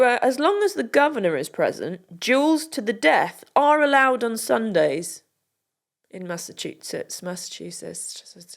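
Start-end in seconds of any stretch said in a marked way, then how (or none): 6.1-8.6 Massachusetts trailed off, much softer and slurred; mumbled Massachusetts. Pretend. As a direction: mangle Massachusetts under the breath. Mangling the state name again.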